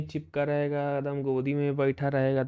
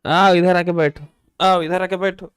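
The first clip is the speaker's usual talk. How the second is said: louder, higher pet talk to the cat; coaxing a pet; 3 semitones higher, 8 dB louder than usual. Real, not acted. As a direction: sweetly coax the cat onto your lap. (बिल्ली को ऊँची आवाज़ में प्यार से बुलाना)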